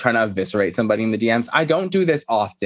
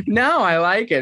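high mock voice; 9 semitones higher, slower than usual. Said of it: "Erica's like".

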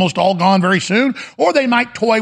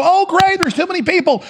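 sarcastic, sing-song and much higher; mock cheerful; 12 semitones higher than usual. Host mocks people who shrug off depopulation, high and sarcastic. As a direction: chirp it like a cheerful fool. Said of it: "We just go".

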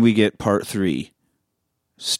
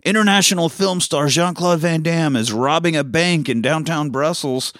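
raised-pitch mock reporter delivery; live news report; 6 semitones higher than usual. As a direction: announce it like a TV reporter, slowing at the end. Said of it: "doing the live report".

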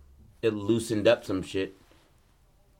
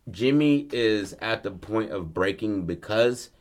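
usual speaking voice

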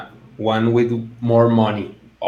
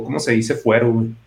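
drops into casual Mexican Spanish; switching languages midsentence; much faster than usual. Real, not acted. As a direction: aside in Spanish to a friend. Asks in Spanish mid English sentence.

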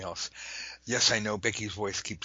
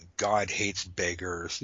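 ordinary delivery

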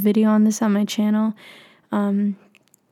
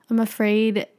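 ordinary talk

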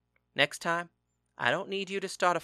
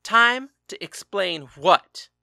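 loud, slow, higher furious echo; angry shout; 5 semitones higher, 9 dB louder, slower than usual. A narrator's reading. Husband throwing his husband's words back, furious shout.

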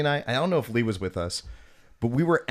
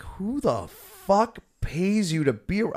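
high, slow disbelieving jab; mock outraged quote; 9 semitones higher, slower than usual. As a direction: sneer it out in disbelief. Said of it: "I'm like".

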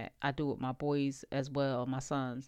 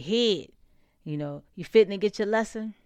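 higher, louder imitation of a Texas father's teasing warning; Texas dad voice; 5 semitones higher, 6 dB louder than usual. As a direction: channel a Texas dad teasing his kid. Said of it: "a strong, um, Texas, way about him".

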